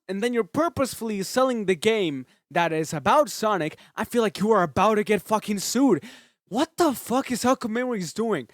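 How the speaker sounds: loud, high angry shouting; a real ranting meltdown; furious rant. Real, not acted. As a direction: scream it, fed up and raging. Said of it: "I'm getting mad".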